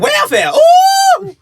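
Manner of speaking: hammed-up falsetto yelp; mock Madea voice. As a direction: stretch it out like a sassy grandma. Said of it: "She's like".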